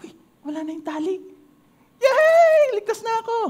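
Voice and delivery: high, bright cheer of freedom; joyful relief. Acted. Mouse discovering the rope is gone, cheering high. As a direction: celebrate being free.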